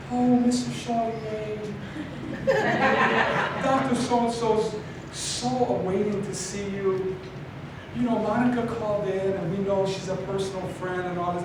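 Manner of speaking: put-on high, soft, sugary old-lady voice; precious older lady, softly gushing; sweet grandmotherly receptionist. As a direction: play a really nice grandma greeting a guest. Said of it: "kinda like a grandmother, just really nice".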